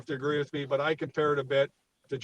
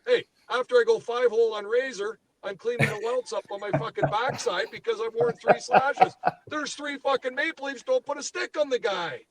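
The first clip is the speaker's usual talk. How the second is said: real high-pitched exasperated tirade; worked up; 8 semitones higher than usual. Real, not acted. Fan venting that nobody put a stick on him, worked up. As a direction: voice climbing high in disbelief at the team. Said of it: "I'm getting a little worked up here".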